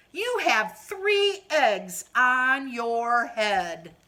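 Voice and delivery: very slow, loud reveal; Piggy breaking the news slowly; slow announcement. Acted. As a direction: spell it out slowly to his friend. Piggy counts, announces eggs.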